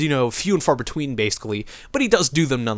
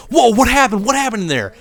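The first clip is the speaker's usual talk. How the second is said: loud, high startled guard asking what happened; a guard caught off guard, blurting it out; 8 semitones higher, 4 dB louder than usual. Retold or imitated. Guard startled by sheriff leaving.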